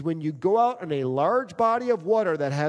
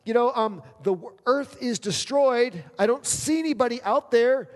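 higher, pleading voice to God; a hint of a weary Noah begging; 7 semitones higher, 3 dB louder than usual. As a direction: beg God to let you off the boat. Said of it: "and you're going".

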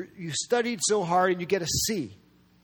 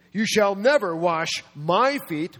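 slow and louder, hitting NEVER; emphatic refusal; 2 semitones higher, 5 dB louder, slower than usual. Acted. Peter refuses to let Jesus wash his feet, emphatic refusal. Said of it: "in the emphatic tense".

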